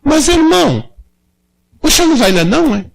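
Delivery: a hint of a curious kid pressing the old man; boy's voice. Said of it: "o piazinho invocou, disse".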